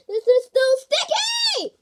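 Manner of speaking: high, loud and slow complaint; slow, loud wail about the slime; frustrated shout. Real, not acted. Slime still not working.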